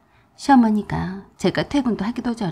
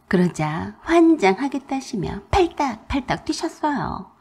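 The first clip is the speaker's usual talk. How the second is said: ordinary delivery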